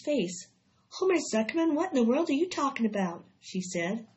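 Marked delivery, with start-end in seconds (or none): 0.9-3.2 high, quick, exasperated bewilderment; bewildered wife. A narrator's reading. Wife questions her husband, bewildered. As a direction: demand an answer, pitched up. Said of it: "A look of complete bewilderment".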